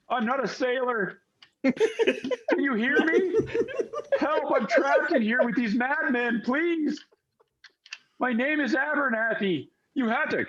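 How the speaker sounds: high, desperate plea for help; trapped soul crying out in a high voice; voice calling out. Acted. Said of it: "then there's one voice, uh, that calls out".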